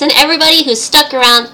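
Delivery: ordinary delivery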